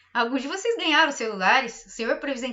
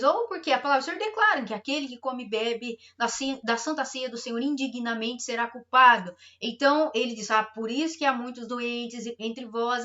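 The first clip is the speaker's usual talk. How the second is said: normal talking voice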